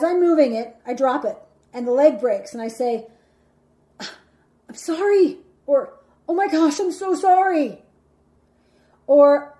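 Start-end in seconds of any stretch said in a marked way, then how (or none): 4.0-5.4 drawn-out, high-pitched sheepish sorry; scared apology. Acted. Dropped the couch and broke the leg, scared apology. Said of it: "like I'm scared". 6.3-7.8 high, louder, flustered gush of apology; alarmed apology. Acted. Dropped the couch and broke the leg, alarmed apology.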